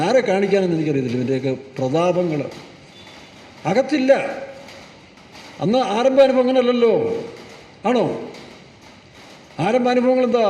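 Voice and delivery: ordinary talk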